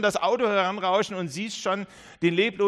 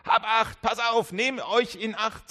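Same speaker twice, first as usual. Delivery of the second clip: higher, louder string of warnings; Jesus urgently calling for caution; 5 semitones higher, 3 dB louder than usual. Acted. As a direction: keep hammering the warning. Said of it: "sagt Jesus".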